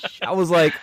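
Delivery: ordinary talk, nothing marked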